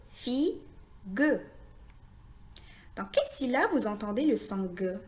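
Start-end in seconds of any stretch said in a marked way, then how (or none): 0.1-1.4 "Figue" as fi, pause, gue; split into syllables. Shown as a demonstration. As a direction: sound out "Figue" with the final e as its own beat. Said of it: "La syllabe fi et la syllabe gue". (« Figue » découpé : fi, gue)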